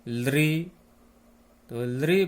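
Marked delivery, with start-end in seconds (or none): 0.1-0.6 लृ said alone, a little louder, tongue against the teeth; sound demo. Shown as a demonstration. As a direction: demonstrate लृ on its own for students to copy. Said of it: "जैसे आप बोलिए".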